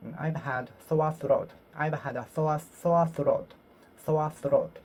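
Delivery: ordinary talk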